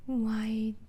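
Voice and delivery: ordinary delivery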